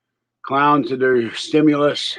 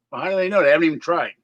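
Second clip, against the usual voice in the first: ordinary delivery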